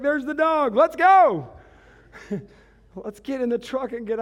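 bursting with relief, let's roll; relieved owner hurrying off with the dog, excited. Retold or imitated. Happy ending, rushing off.

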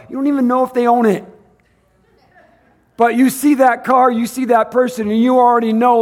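high, loud, driving sermon voice; fiery preaching. Acted. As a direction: preach it out to the church. Preaching at full force.

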